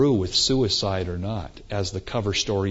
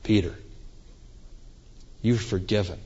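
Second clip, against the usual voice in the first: quiet, soft forgiveness; a soft, forgiving word to Peter; tender Jesus; 3 semitones lower, 8 dB quieter than usual. Acted. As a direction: speak it gently, with pauses. Risen Jesus speaks privately to Peter.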